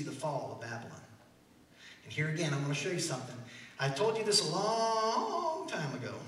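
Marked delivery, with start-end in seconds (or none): 3.8-6.1 drawn-out country twang, voice swooping up. Pretend. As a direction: stretch it out in a playful country drawl. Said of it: "That's my country voice".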